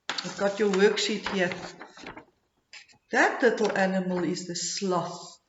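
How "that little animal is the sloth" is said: normal talking voice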